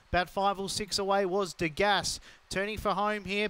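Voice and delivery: fast, rising live race call; race caller. Real, not acted. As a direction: call the race as it unfolds, building.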